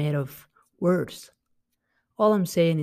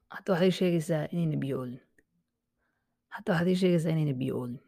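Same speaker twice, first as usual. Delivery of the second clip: rapid, disbelieving question; fast incredulous dad; much faster than usual. Shown as a demonstration. Dad asks his child, fast and incredulous. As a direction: rattle off the dad's disbelief. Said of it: "he's like".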